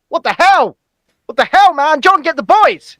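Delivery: loud, high mock-outraged call; mock outrage. Host calling for backup over missing likes, mock outraged shout. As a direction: holler it in pretend alarm. Mock alarm at viewer and like count.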